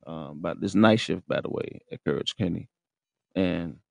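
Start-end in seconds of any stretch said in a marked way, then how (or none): none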